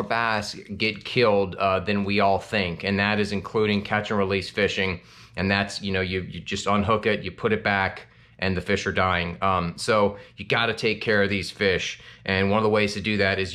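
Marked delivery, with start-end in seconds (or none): none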